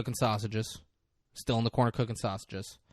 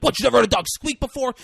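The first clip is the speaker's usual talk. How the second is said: high, loud and fast, fed up; exasperated shout; 13 semitones higher, 9 dB louder, much faster than usual. Retold or imitated. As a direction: yell it like he can't believe the question. Acted character snapping back.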